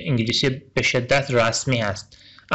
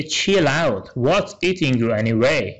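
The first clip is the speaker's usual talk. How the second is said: slowed to half pace, every word clear; slow, careful model line; slow dialogue reading; much slower than usual. Shown as a demonstration. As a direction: spell it out slowly for learners. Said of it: "ان میگه".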